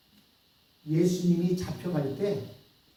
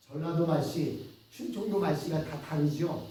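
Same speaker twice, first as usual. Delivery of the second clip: ordinary delivery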